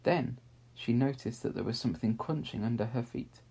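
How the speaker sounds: plain reading voice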